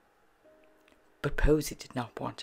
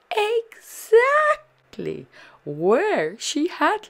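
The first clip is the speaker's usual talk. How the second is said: slow, high, loud emphasis; delighted reveal; 12 semitones higher, 10 dB louder, much slower than usual. A narrator's reading. Narrator reveals Posy found her frog, delighted emphasis. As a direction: stretch it out for young listeners.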